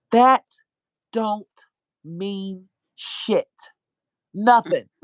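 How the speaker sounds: THAT and NOTHING loud, long pauses between words; emphatic dismissal. Real, not acted. Preacher-like reassurance to the sick, THAT and NOTHING hit hard. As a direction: punch THAT and NOTHING, each word set apart. Emphatic answer to listeners' excuses.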